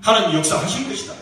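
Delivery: ordinary delivery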